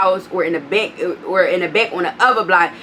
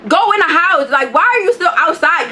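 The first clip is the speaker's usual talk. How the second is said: exasperated; 7 semitones higher than usual. Real, not acted. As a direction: snap it at the dog walker.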